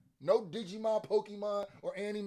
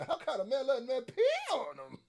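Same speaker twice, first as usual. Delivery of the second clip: imitating the rapper; 8 semitones higher, faster than usual. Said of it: "His voice and his flow here is getting weirdly animated".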